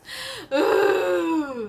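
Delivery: loud, high laugh with a cry; loud nervous laugh. Real, not acted.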